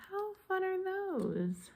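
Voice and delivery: high, soft, drawn-out coo; delighted coo. Real, not acted. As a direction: coo slowly over something cute, high and soft. Delighted by the little markers.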